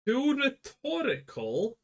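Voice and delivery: high, slow disbelieving question; incredulous. Real, not acted. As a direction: squeak out the disbelief. Incredulous at co-host's phrasing.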